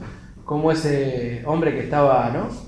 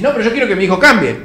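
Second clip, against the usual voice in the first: fast, higher, louder protest; stubborn parent objection; 6 semitones higher, 9 dB louder, faster than usual. Acted. Parent answering God about their son, stubborn protest. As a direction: cut in insistently, not listening.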